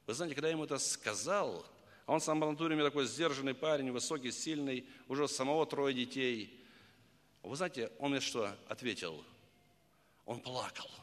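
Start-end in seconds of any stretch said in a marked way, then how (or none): none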